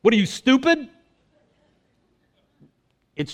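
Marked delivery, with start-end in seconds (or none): 0.0-0.9 mock scoff, higher pitched; scoffing at a clueless stranger; incredulous Cleopas. Cleopas scoffing at the stranger on the road, mock incredulous. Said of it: "And Cleopas is like".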